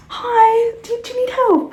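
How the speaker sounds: high, loud eager shop assistant; mock shop assistant. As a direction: pounce on her with forced cheer. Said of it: "he was like".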